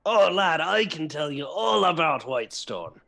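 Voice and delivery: put-on hearty drunken old pirate voice; put-on pirate brogue, rising high on the opening words. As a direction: slip into a lilting, tipsy sea-dog voice. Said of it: "not going to do that voice".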